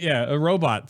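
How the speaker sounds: ordinary delivery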